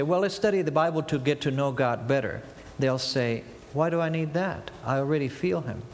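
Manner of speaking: ordinary delivery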